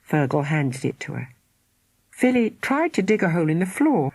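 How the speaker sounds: ordinary delivery, nothing marked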